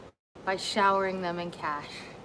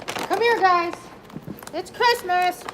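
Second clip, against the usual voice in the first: high, bright call to the dogs; rally the dogs for Christmas; excited call; 8 semitones higher than usual. Real, not acted.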